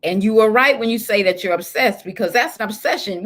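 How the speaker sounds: ordinary talk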